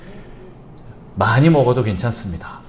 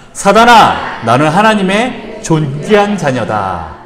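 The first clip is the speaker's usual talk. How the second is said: louder, slow defiant proclamation; firm shout at Satan; 4 semitones higher, 7 dB louder, slower than usual. Shown as a demonstration. Pastor leads the congregation in shouting at Satan, defiant. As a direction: declare it at the devil, slowly. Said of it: "세 번째, 시작".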